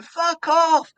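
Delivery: loud high jeering yell; crowd heckle. Retold or imitated. Crowd yelling at the stalling compere, jeering. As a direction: hurl it at the stage. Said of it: "they start going".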